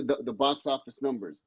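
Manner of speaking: ordinary delivery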